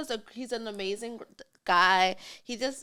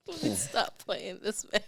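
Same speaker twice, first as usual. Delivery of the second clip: laughing; 4 dB quieter than usual. Real, not acted. Laughing plea to friend.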